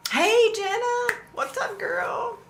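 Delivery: very high, slow, drawn-out hello; high sing-song greeting. Real, not acted. Live streamer squealing hello to a viewer.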